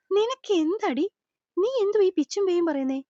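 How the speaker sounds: high, sharp, exasperated scolding; angry rebuke. A narrator's reading. Sree scolding Devu for her self-blame, angry and high. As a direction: scold her for talking nonsense, voice rising. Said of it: "പല്ല് ഞെരിച്ചവൻ അവളെ ഒന്ന് നോക്കി കടുപ്പിച്ചു".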